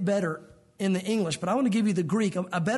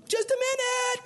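much higher, strained call; flustered stalling; slower than usual. Acted. Homeowner calling high and flustered to the pastor at the door. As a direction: call out high, buying time.

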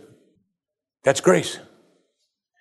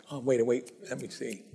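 softer, fading, fumbling to remember; mock forgetful God; 11 dB quieter than usual. Preacher mocking the idea of God forgetting, soft and fumbling. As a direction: play a forgetful old man searching his memory.

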